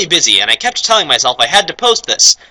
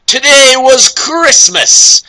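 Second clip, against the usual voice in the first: mock excitement; 9 semitones higher, 4 dB louder, much slower than usual. Reading fanfic excitedly.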